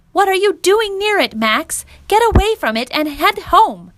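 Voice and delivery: high-pitched, insistent mother; alarmed mom, swooping high and loud; mother ordering. A narrator's reading. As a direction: order your son away from danger. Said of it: "ordered his mother".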